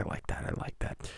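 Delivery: trailing off into a whisper; whispered praise after the caller hangs up. Real, not acted. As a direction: breathe it out softly as the praise fades. Praise fading away to a whisper.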